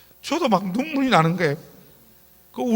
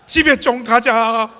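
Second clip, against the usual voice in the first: exasperated plea; 3 semitones higher, 10 dB louder, slower than usual. Retold or imitated. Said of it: "제발 그만하라는 거".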